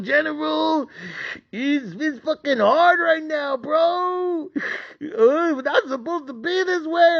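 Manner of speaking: high wailing sobbing rant; crying breakdown. Retold or imitated. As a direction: bawl it out, voice cracking.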